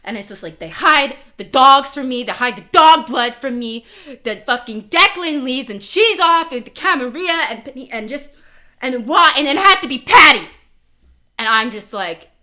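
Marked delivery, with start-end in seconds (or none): 0.6-10.5 rising, louder angry rant; mad rant. Pretend. Player character raging about what she was kept from, angry. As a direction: spit it out in rising anger. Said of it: "like, I'm mad".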